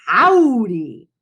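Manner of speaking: a lightly voiced teacher drawling a folksy howdy; a hint of a playful cowboy teacher; cowboy greeting. A narrator's reading. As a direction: greet the kids like a friendly cowboy.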